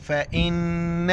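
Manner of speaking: फ़इन्न chanted with the double n held in the nose; nasal ghunna. Shown as a demonstration. Teacher demonstrating ghunna on noon, nasal hold in फ़इन्न. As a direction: linger on the humming न्न of फ़इन्न. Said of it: "नून पर गुन्ना करेंगे".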